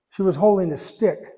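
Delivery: ordinary talk, nothing marked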